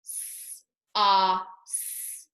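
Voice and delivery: S, ah, s drawn out one sound at a time; hissed S, held ah, hissed s, with gaps; sounds stretched out. Shown as a demonstration. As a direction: stretch each sound: long S, open ah, long s. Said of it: "Let's stretch out the sounds".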